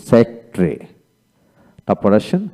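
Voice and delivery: ordinary talk, nothing marked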